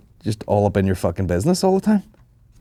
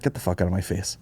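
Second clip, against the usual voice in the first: muttered retort; 2 semitones lower, 10 dB quieter than usual. Retold or imitated.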